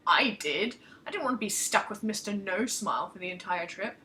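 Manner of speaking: ordinary delivery, nothing marked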